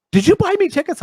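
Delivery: very high, squealing excited question; excited girlfriend. Retold or imitated. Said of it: "she goes".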